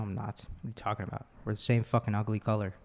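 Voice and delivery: ordinary talk, nothing marked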